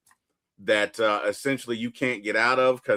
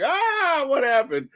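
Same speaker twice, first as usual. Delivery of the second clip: sudden high startled cry; mock shock; 13 semitones higher than usual. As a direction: jump up in pitch, startled. Thor's shocked reaction, retold.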